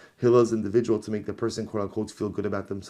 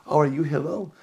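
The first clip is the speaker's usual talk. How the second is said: higher, louder sneering question; mock taunting; 6 semitones higher, 5 dB louder than usual. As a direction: taunt him with fake surprise. Man baiting Hillel.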